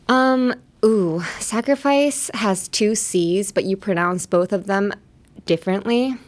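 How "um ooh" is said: usual speaking voice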